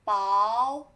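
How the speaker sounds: loud 薄 as báo; báo reading. Shown as a demonstration. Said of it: "another is".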